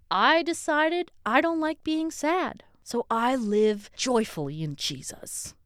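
a hammed-up happy convert; chirpy churchgoer. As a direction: play up a sugary believer's testimony. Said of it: "the next sentence would be like".

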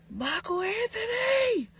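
high, drawn-out mock call of a full name. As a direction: call the name across the house like a mom. Mom calling out full name.

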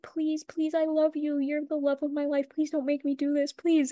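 high, insistent imitated pleading; acted desperate begging.

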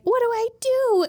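high, loud helpless cry; mock panic. Kaya threatened with death, mock-panicked cry. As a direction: wail it in hammed-up panic.

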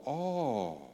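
soft, let-down little "Oh."; deflated oh. Retold or imitated. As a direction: say it like the air just left you. Deflated reaction to punchline.

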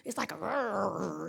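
low, soft, drawn-out growl imitation. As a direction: growl softly like a dog at play. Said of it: "it's a deeper growl".

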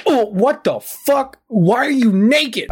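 high, drawn-out incredulous outburst; imitated shocked sergeant. Sergeant finds him in underwear.

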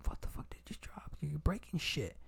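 whispered imitation of mom's angry call; understated mock angry mom; hushed retold yelling. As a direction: mutter it under your breath as mom. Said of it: "You know, like".

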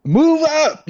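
very high, loud mimicked command; imitated battlefield yell. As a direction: belt out her order to the troops. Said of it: "yelling at every mother's son".

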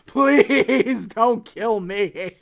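mock grovel, drawn out; hammed-up begging on his knees; groveling plea. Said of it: "he grovels".

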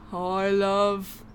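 slow, drawn-out mock English accent; hammed-up English accent, laughing through it. Impersonating a British star's greeting, English accent. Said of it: "that's actually how he talks".

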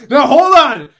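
laughing. Real, not acted. Host laughing at bad pick.

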